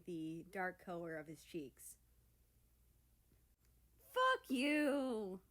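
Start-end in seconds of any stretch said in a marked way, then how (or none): 4.1-5.4 loud, high flustered snarl; embarrassed growl. A narrator's reading. Teased country snaps at Russia, flustered and loud. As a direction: snap it back, cheeks burning. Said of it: "America growled".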